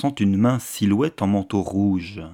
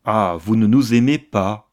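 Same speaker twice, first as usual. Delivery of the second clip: raised-volume narrated outcry; exclamation; 6 dB louder than usual. A narrator's reading. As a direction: exclaim it louder, in moderately acted dialogue. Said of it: "s'écria".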